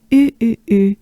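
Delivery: U as French "u", lips rounded; French U sound. Shown as a demonstration. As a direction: drill a droopy French U.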